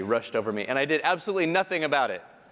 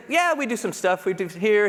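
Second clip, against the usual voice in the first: colleague's reply; 7 semitones higher than usual. Retold or imitated. Colleague answers, voiced higher.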